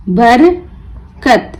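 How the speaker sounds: बरकत slowly split, बर, pause, कत; broken into syllables. Shown as a demonstration. Teacher breaking the word for learners, बर... कत with a gap. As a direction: pull बरकत apart into बर and कत. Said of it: "तोड़ तोड़ कर पढ़ना सीखें".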